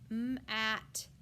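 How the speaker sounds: "M-at" with the M held apart from "at"; sounded out. Shown as a demonstration. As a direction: break "mat" into "M" and "at". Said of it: "Next word is mat".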